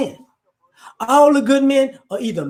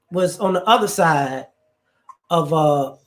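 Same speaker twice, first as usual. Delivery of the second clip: ordinary talk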